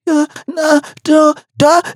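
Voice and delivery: high, halting mock stammer; Musa. Musa can't speak properly while being beaten, mocking stammer. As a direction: imitate a man tripping over half-formed syllables. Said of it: "Musa can't speak properly".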